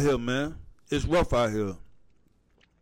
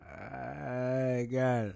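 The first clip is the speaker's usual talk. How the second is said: put-on Jamaican accent. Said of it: "The Jamaican accent".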